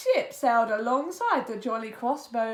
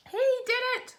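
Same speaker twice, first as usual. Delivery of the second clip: high squawking parrot voice; 10 semitones higher, much faster than usual. A narrator's reading. Parrot squawking blame at Pete, high squawk. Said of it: "squawked the parrot".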